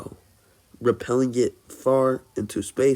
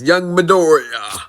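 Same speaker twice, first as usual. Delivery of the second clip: higher, louder heroic hero voice; booming All Might; 6 semitones higher, 8 dB louder than usual. Acted. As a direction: call out like a big booming hero. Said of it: "All Might appears and says".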